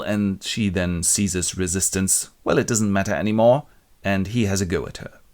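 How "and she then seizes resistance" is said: ordinary delivery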